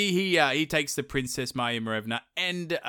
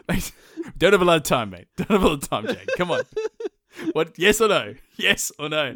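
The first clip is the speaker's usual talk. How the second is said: impatient villain; 5 semitones higher, 5 dB louder than usual. Retold or imitated. Koshi pressing his horse.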